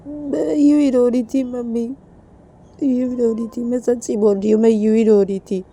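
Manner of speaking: lightly voiced plaintive girl; a touch of a whiny child; pleading Reshma.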